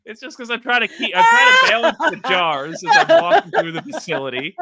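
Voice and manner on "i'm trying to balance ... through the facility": high, loud laughing speech. Real, not acted. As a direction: laugh through the words. Laughing through absurd story.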